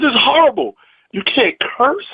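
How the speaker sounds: high-pitched retold disbelief; incredulous protest. Comic objects to the club's no-cursing rule, incredulous. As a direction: protest as if retelling an absurd rule. He argues back in retold quarrel.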